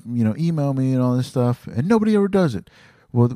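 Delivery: ordinary talk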